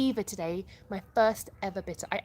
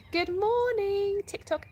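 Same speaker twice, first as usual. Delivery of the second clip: high, slow drawn-out good morning, pitched way up; sing-song greeting; 13 semitones higher, 4 dB louder, slower than usual. Shown as a demonstration. For a viewer asking how she talks, a high, slow sing-song greeting. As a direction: stretch each word of morning long and high. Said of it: "how do you talk".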